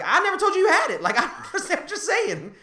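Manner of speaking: retold argument, voice jumping up and laughing; incredulous denial.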